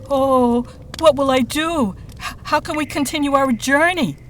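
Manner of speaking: high, wailing moan; a distressed master moaning that the horse is lost; moaning sage. Acted. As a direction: wail it out like a helpless, frightened monk. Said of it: "moaned the sage".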